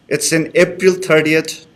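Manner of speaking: ordinary talk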